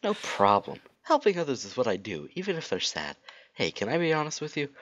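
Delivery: high, eager hero voice; earnest Sonic. Acted. Sonic offers Rogue help and honesty, high earnest voice. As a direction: pitch it up, helpful and earnest.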